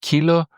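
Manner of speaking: kilo with stress on the final o; Greek kilo. Shown as a demonstration. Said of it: "look first at a real word".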